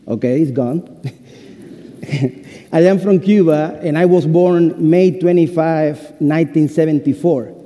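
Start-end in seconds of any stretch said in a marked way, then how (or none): none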